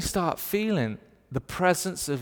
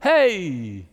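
pitched-up, loud, smiling hey; tone up greeting; 3 semitones higher, 7 dB louder than usual. Retold or imitated. Preacher showing the Canadian's head-up greeting, pitched up and beaming. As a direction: lift the tone and throw out a big hello. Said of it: "Head up, tone up".

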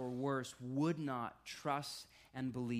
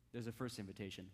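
normal talking voice

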